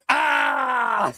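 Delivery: a high imitated triumphant yell; gleeful grab yell. Johnny snatching the foul ball from kids, triumphant yell. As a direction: shout it like you just snatched the ball. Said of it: "he goes".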